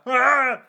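high, loud yelp of someone on fire; mock burning scream. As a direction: cry out as if set alight, hammed-up. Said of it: "he's just on fire".

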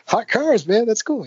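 hammed-up delight at his own title; retelling a younger self's naive enthusiasm; mock excitement. Self quoting past enthusiasm.

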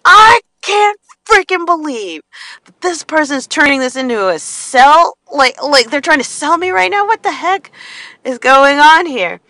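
mock fury at being sold to; imitating angry self. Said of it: "I would just get so angry".

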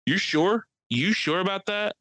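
high, teasing disbelief; mock doubt. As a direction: needle her with a skeptical sneer. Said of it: "I'm like".

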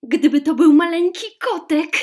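high, loud, delighted cry of a princess; joyful exclamation. A narrator's reading. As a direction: clap and cry out with glee. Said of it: "klasnęła z radości w ręce i zawołała".

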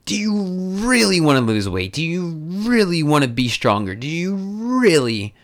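REALLY stretched long with a rolled-out start. Real, not acted. Pressing listener on true desire.

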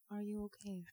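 very soft imitated interviewer question; as if her own idea. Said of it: "says it like she thought of it".

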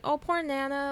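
lightly acted sympathy for a poor dog; mock pity. Everyone fussing over Nana the dog, mock pity. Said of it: "everyone's like".

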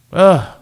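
a short disgusted ugh; frustrated groan. Real, not acted. Fan groaning at his team's offense, short disgusted ugh.

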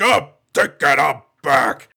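lightly voiced gravelly Batman growl. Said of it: "his Batman voice".

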